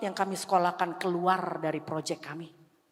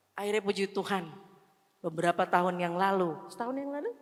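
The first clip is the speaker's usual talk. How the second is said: ordinary delivery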